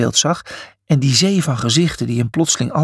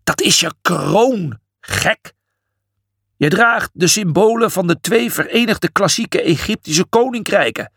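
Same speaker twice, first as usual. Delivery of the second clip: higher, indignant lecture; trembling with anger; 5 semitones higher than usual. A narrator's reading. Imhotep explaining the crown to Julius, furious. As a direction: scold in outraged tones. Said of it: "Trillend van woede".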